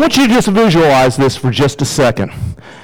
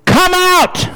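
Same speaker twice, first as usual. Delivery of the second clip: very high, ringing summons; high sustained commanding call; Jesus calling out. Acted. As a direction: call the dead out by name, pitched way up. Acting Jesus calling the dead out.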